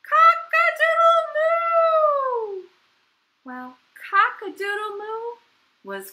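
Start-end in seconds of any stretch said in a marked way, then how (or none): 0.1-2.7 high, loud, drawn-out moo-crow; crowing cow. A narrator's reading. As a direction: crow slowly, letting the moo ring out. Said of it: "And the cow went".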